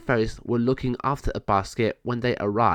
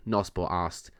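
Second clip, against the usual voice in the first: plain reading voice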